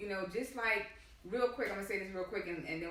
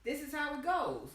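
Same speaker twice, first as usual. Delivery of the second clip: imitating a smug, higher-pitched explanation; mock self-satisfied voice, pitched up; 5 semitones higher than usual. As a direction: act out someone smugly laying down how it works. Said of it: "He was so smug and saying".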